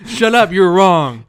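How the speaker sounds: loud, high comic telling-off; mock angry. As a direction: shout an over-the-top mock insult. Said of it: "angry humor's kinda cringy".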